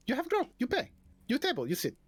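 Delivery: high kobold giving simple orders; high-pitched little innkeeper; kobold barkeep. Acted. Kobold gives customers orders.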